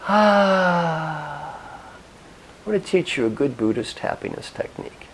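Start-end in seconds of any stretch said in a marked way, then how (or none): 0.1-1.6 long audible sighing "ah"; big demonstrative breath out; loud exhaled sigh. Shown as a demonstration. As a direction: let the breath out in one long, higher, held sigh. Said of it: "Take a deep breath".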